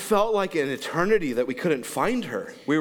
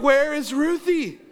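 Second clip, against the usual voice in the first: higher, louder, slow alarmed question; panicked call; 9 semitones higher, 4 dB louder than usual. Retold or imitated. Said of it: "We're like".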